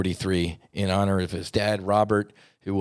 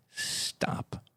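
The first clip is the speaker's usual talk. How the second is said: whispered stop; 10 dB quieter than usual. Real, not acted. Pleading for violence to end.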